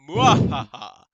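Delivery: loud gloating villain cackle; evil laugh. Acted. Villain laughs after executing.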